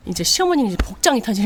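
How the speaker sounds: ordinary talk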